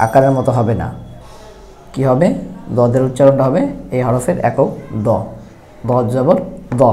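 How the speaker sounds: usual speaking voice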